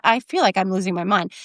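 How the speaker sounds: usual speaking voice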